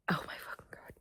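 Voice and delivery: voiceless whisper of dismay; exasperated whisper remembering a bad week; whispered exasperation. Real, not acted. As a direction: breathe the curse under your breath.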